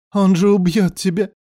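high, terrified exclamation; horrified. A narrator's reading. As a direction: plead in horror, pitched high. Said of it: "с ужасом в дрожащем голосе прошептал".

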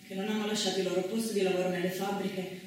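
usual speaking voice

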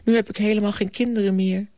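high, plaintive teacher voice; acted high-pitched wail; close to tears. A narrator's reading. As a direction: lament it high, as if about to cry. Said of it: "stond het huilen nader dan het lachen".

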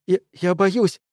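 high, scared outburst; frightened cry. A narrator's reading. Opal cries out to the group in fear. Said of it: "вскричала Опаль".

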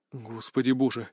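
soft, low gasp of words; breathed out. A narrator's reading. Hero stunned after the vampire attack: soft breathed exclamation. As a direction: let it out on a breath. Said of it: "выдохнул я".

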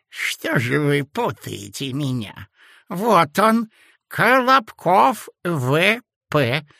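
put-on sweet-talking sinister old man; sly old man. A narrator's reading. Old clerk character voice. (вкрадчивый зловещий старичок)

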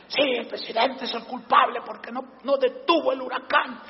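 lightly voiced politician on the news; imitating a politician. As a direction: lightly mimic the pundit's claim. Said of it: "estaba uno diciendo".

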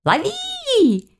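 high, long-drawn chase call; pursuers' cries. A narrator's reading. Pack of girls chasing her from behind, drawn-out calling. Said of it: "донеслось в спину".

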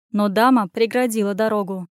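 usual speaking voice

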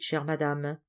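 usual speaking voice

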